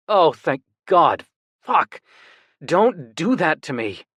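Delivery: slow, loud shaky relief; panicked relief. A narrator's reading. Relieved brother after panic.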